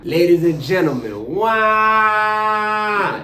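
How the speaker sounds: put-on loud, high New Orleans accent. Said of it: "In my most New Orleans voice ever".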